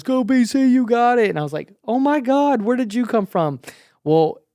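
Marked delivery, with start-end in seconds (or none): 0.0-1.3 loud, high cheer from the sidelines; imitating a friend's excited yell. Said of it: "and he yells". 1.9-3.6 high, loud burst of surprise; re-enacted shock at spotting a friend; astonished. Acts out astonished reaction to supporter.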